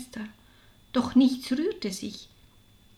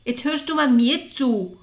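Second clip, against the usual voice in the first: louder, insistent cut-in; calling out insistently; 3 semitones higher, 7 dB louder than usual. A narrator's reading. Martha interrupts her sister to be heard, insistent exclamation. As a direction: demand attention with a raised voice. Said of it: "rief sie".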